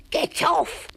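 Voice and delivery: high, loud angry bellow; loud shout. A narrator's reading. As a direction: blast him off the rock. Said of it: "shouted the voice so loudly".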